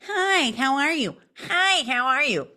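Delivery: high nasal impression; Fran Drescher. Shown as a demonstration. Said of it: "So imagine Fran Drescher".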